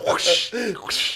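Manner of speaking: high, airy move sound effects; anime whooshes. Retold or imitated. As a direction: imitate whooshing anime moves. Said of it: "like, anime moves as they could".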